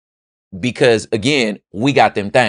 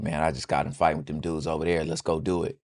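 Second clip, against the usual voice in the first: quick, much softer and lower, rushed; hurried low mutter; 4 semitones lower, 10 dB quieter, faster than usual. Pretend. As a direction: blurt it fast under your breath to the homies. Friend runs up out of breath.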